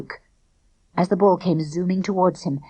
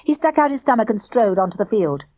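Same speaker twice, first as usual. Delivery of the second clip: usual speaking voice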